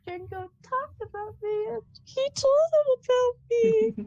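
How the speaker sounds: high, slow, tearful wobble; slow and teary, overwhelmed that someone cares; Zapiel near tears. Acted. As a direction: say it like you're about to cry. Zapiel near tears over Jango.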